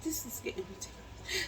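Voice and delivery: exasperated whisper; whispered frustration. Retold or imitated. Patient losing patience on the table, whispered. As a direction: grumble it under your breath.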